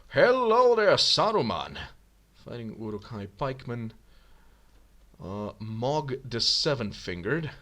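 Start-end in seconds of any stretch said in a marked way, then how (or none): 0.1-1.8 loud, high mock hail; calling out greeting. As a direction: sing out a big hello. Calls a greeting to the wizard.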